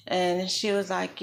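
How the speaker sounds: ordinary talk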